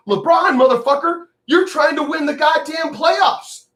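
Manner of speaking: a real furious yell, pitch way up; furious shout. Real, not acted. Angry rant at LeBron.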